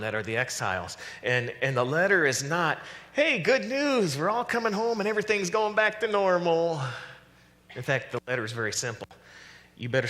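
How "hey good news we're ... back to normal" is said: hammed-up, much higher jubilant herald; mock good news. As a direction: proclaim happy news in an exaggerated sing-out voice. Mock cheery letter to exiles.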